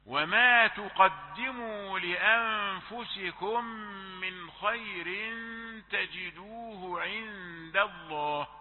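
slow chanted tilawa; Quran recitation. Acted. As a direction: recite the verse slowly. Recites verse. (تلاوة مرتلة)

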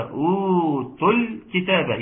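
"أوتوا الكتاب" with long first u and short "tul"; second vowel unlengthened. Shown as a demonstration. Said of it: "الواو الثانية لا مد فيها".

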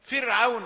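"فرعون" with a thin, light r. Shown as a demonstration. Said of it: "راء فرعون مرققة". (ترقيق الراء في "فرعون")

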